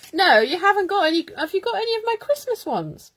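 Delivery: high, loud excited laughing. Real, not acted. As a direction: squeal with delight.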